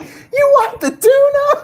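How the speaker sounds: very high-pitched mock yell; hammed-up imitation of a wrestler's taunt; imitating her catchphrase. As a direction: squeal it slowly and loudly, half laughing. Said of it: "she just looks around. She's like".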